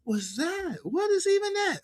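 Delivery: mock baffled high voice; mock disbelief. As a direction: react like it made no sense. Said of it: "I was like".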